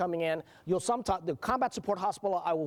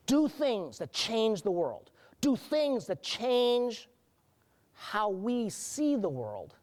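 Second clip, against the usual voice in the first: slow emphatic oratory; 2 semitones higher, much slower than usual. Real, not acted. Rousing close of speech.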